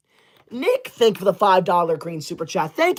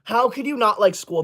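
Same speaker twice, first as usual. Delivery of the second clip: ordinary talk, nothing marked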